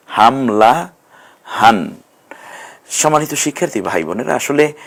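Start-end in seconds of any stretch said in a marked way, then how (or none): 0.1-1.9 হামলাহান drawn out very slowly; slow model word. Shown as a demonstration. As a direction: say হামলাহান slowly, every syllable clear. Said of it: "একটু ভেঙে ভেঙে বলুন".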